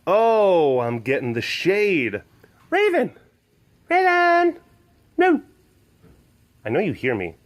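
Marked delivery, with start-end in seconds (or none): 2.7-5.4 high, loud call to the cat; calling the ignoring cat, high and loud. Real, not acted. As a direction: call her name across the enclosure.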